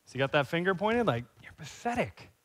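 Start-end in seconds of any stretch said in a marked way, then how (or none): 1.4-2.3 a lightly voiced cold accuser, softer, slow on the second line; accusing enemy voice. The enemy's voice condemning the listener, soft mock contempt. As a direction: murmur the enemy's put-down, drawn out. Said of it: "That's not God's voice".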